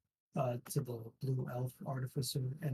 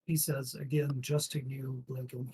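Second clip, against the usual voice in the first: normal talking voice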